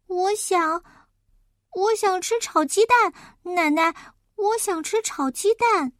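put-on high, insistent kid voice; pleading child. Child asks grandma for fried eggs, high pleading. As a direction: ask for it like a hungry child.

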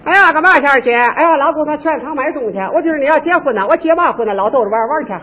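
put-on two Tianjin girls chatting in a lightly done local accent; mild Tianjin dialect impression. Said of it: "我学天津的啊".

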